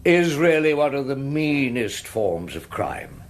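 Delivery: rising barrister's appeal to the jury; courtroom oratory. Acted. Barrister addressing the jury, building indignant oratory. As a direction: plead the case with mounting indignation.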